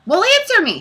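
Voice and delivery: shrieky-high, loud demand. A narrator's reading. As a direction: demand an answer at once. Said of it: "she demanded".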